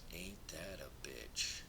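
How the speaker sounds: slow wry whisper; slow, hushed Ain't that a bitch. Real, not acted. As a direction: breathe it out as a knowing aside. Wry aside after a revelation.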